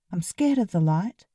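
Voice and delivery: usual speaking voice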